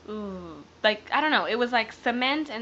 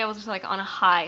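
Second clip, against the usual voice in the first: usual speaking voice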